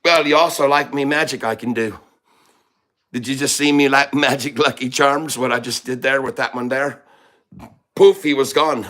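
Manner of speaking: hammed-up Irish accent. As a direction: boast like an Irish leprechaun. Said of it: "We all love your accents".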